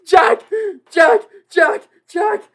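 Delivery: high, loud, frantic calling; yelling his friend's name in excitement; frantic shouting. Real, not acted.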